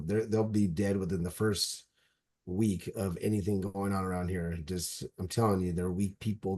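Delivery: normal talking voice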